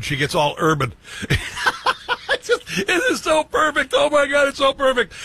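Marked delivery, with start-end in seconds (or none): 1.1-2.3 high breathy cackle of laughter; helpless high laugh; burst of laughter. Real, not acted. Host cracks up at clip. 2.3-5.1 words squeezed out through laughter, higher; real delighted laughter; laughing. Real, not acted. Host laughing at clip.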